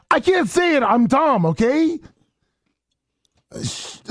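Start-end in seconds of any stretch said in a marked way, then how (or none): 0.1-2.0 high-pitched exasperation; exasperated outburst. Real, not acted. To co-hosts pushing him to say acai, exasperated. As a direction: give up in a high, pleading protest. Teased over failed pronunciation.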